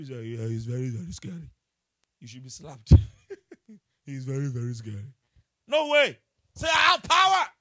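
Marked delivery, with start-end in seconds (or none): 0.0-1.5 low mock-fearful father; mock scared dad. Mocking a timid father watching cartoons. 4.1-5.1 low, loud mock-fearful voice; hammed-up timid dad; mock scared dad. Mocking the timid father once more. 5.7-7.5 loud, high preacher's rallying cry; commanding shout. Real, not acted. Rallies congregation to declare power.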